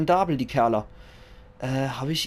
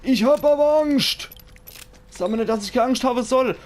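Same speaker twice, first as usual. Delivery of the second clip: much higher, louder whiny mock-scared voice; hammed-up fear; 11 semitones higher, 6 dB louder than usual. Talking back to the doctor's reassurance as a comically terrified Leslie, whining high and loud.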